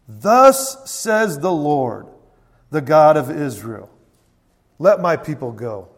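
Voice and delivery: slow, loud, weighty declaration; loud proclamation. Acted. Moses delivers God's command to Pharaoh, loud proclamation. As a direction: proclaim it at full volume, spacing the phrases.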